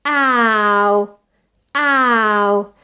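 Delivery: long "Ow" sliding from ah towards u; diphthong glide. Shown as a demonstration. Teacher demonstrating A position towards O, drawn-out glide. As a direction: stretch "ow" evenly through both positions. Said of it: "starts at an A position and goes".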